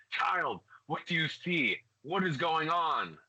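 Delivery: high, urgent questioning of a student; a mentor gripping the child's shoulders; concerned master. Acted. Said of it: "He says".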